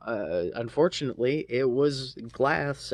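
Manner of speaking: ordinary talk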